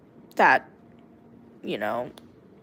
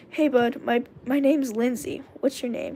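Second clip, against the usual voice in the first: plain reading voice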